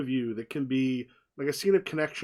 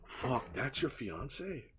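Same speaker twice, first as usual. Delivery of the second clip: slow, much softer, stunned realization; stunned hush; 2 semitones lower, 11 dB quieter, much slower than usual. Acted. Cod quietly realizes the man at the bar is her fiance. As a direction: let it sink in quietly.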